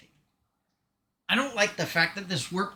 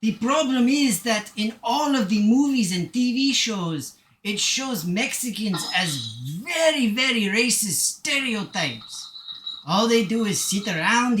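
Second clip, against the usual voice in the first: emphatic put-on Mexican accent; Mexican accent attempt. Shown as a demonstration. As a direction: explain earnestly in a Mexican accent, stretching key words. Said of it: "want to do a Mexican accent".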